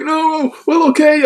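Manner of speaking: high, loud meek English guy; cowed English guy. Retold or imitated. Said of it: "be like".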